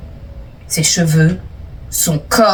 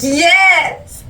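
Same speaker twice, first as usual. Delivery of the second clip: excited cheer; 8 semitones higher than usual. Acted. Cheering a reader's anecdote, excited high yelp. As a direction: cheer at the story like a fan.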